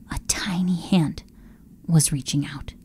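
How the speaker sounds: breathless whisper of wonder; hushed whisper. A narrator's reading. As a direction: barely breathe the words. Hushed moment of discovery.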